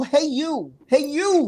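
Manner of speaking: high, swooping "Hey You"; catchphrase call. Shown as a demonstration. Host corrects how he read the greeting, high catchphrase call.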